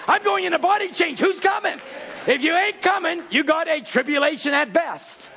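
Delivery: high, shouted preaching; preaching at full force. Acted. Preacher urging the church to come, shouting. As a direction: whip the congregation up, shouting.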